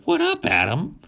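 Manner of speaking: very high, breezy hustler serpent; cocky snake voice. Retold or imitated. Talking snake pushing an apple on Adam, very high and breezy. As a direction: sell it like a street dealer.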